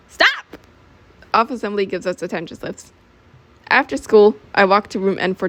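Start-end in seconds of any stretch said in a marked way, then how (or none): 0.1-0.4 very high-pitched cry to halt; shrieked order; sharp command. Acted. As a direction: break up the fight with a sudden high shout. Officer breaks up roast battle.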